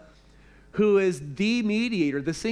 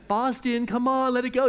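higher, louder coaxing call to the dog; 7 semitones higher, 3 dB louder than usual. Retold or imitated. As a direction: coax the dog to let go. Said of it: "to get it out of his mouth".

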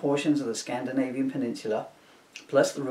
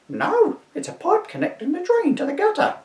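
put-on high shrieking old woman; an indignant old dear shooing a Viking; old lady to constable, high and indignant; crone voice; 3 dB louder than usual. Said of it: "my crone voice".

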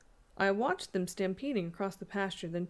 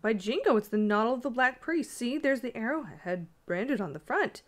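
raised, louder burst of discovery; exclaiming; 5 semitones higher, 6 dB louder than usual. A narrator's reading. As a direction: announce an astonishing find excitedly. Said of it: "I exclaimed".

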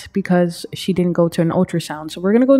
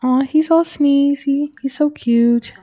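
cooing; 7 semitones higher, slower than usual. Real, not acted. Player adoring the baby Sim, high slow cooing.

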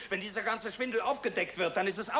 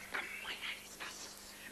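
hushed aside whisper, breath only; 14 dB quieter, slower than usual. Acted. Whispering an aside to the cousin during introductions. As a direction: slip it out in a secret whisper.

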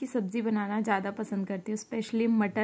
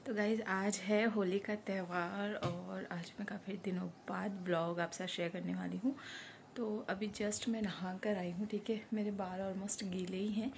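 breathy unvoiced whisper, very soft; 8 dB quieter than usual. Real, not acted. Vlogger whispering to viewers while her baby sleeps. Baby asleep, talking softly.

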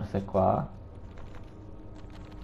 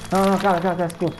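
frantic pleading; 8 semitones higher, much faster than usual. Real, not acted.

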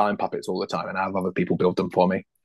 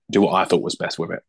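usual speaking voice

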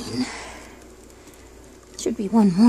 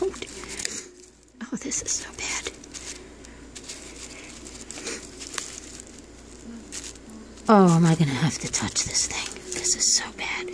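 whispering; 6 semitones lower, 4 dB quieter than usual. Real, not acted. Dreading touching the goo.

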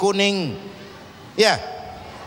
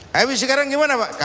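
curious son; 5 semitones higher, faster than usual. Acted. Son asking his father what to do next, high and eager. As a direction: ask like an eager young son.

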